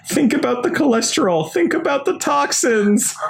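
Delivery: high whiny pleading, slowing on toxins; pleading witch. Retold or imitated. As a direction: beg them like a health nut in a high voice. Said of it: "pleading in with them".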